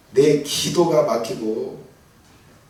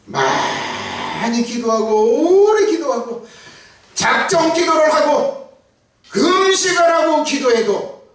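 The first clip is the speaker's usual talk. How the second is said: rising, impassioned sermon build, slowing on each phrase; fervent preaching; 10 semitones higher, 5 dB louder, slower than usual. Acted. Preacher pressing the congregation about unanswered prayer, impassioned. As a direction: preach it higher and louder, pressing every clause.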